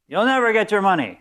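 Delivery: high, loud taunt down to the neighbour; gleeful shout out window. Retold or imitated. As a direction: shout it down triumphantly. Wife yells out window.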